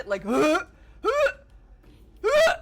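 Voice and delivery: incredulous, building to a yelp; disbelief. Real, not acted. Disgusted reaction to text.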